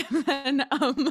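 laughing loudly while trying to carry on. Real, not acted. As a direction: talk through real laughter, high and loud. Laughing after saying triggering.

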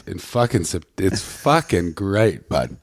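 slow Ottawa Valley accent. Shown as a demonstration. Comic demonstrating home dialect to the host, Ottawa Valley accent. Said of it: "that's a very Ottawa accent".

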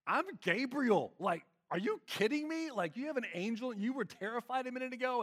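an angel who can't believe he's being doubted; shouting in mock outrage, are you kidding me; incredulous angel Gabriel. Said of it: "he goes".

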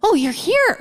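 high, surprised "oh, you're here"; startled high host voice; caught-off-guard host. Retold or imitated. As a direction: play the flustered host who forgot. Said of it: "they're like".

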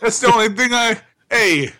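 gruff high wiseguy growl; mobster voice. Acted. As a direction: threaten nicely, like a mob guy. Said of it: "when we do the mobster voice".

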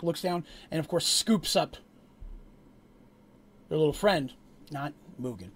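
ordinary talk, nothing marked

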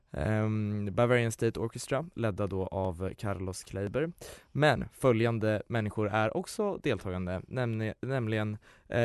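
ordinary talk, nothing marked

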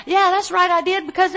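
smug I-did-it snap, hammed-up; mock defiance. Shown as a demonstration. Pastor acts out spouse defending themselves, mock defiant. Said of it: "Don't, don't fire back".

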